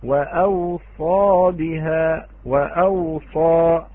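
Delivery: وأوصى very slow with a long hamza and aw, wa'awsa; Warsh reading. Shown as a demonstration. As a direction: drag out وأوصى, stressing the hamza after the first waw. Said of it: "فقرأها ورش". (قراءة ورش وأوصى بهمز بعد الواو ببطء شديد)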